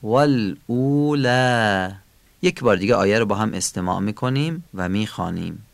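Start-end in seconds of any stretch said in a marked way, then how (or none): 0.0-1.9 والاولی slowly, ending on a drawn-out fronted "aa"; long light final alef. Shown as a demonstration. Teacher demonstrating the final alef of والاولی, long light vowel. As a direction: stretch the last vowel of والاولی thin and long.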